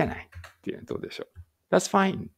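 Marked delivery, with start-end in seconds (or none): 1.7-2.3 bright model phrase. Shown as a demonstration. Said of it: "こういうふうにも言うわけです".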